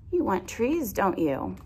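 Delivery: plain reading voice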